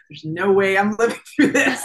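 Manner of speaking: laughing through the memory of doom; laughing disbelief. Retold or imitated. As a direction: chuckle through the impossible odds. Retelling certain death, laughing.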